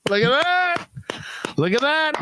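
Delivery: high, pleased exclamation; delighted. Real, not acted. Host proud after checking the French accent, excited. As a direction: crow with delight at being right. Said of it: "Now I've taught somebody something".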